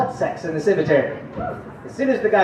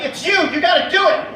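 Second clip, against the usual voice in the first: raised, pressing mock urgency; urgent urging; 10 semitones higher, 4 dB louder than usual. Staff pushing the new doctor to deliver, urgent raised voice. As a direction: push him to step in.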